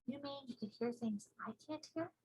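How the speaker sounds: put-on soft girlish reply; quiet small girl; little girl voice. Sophie amazed.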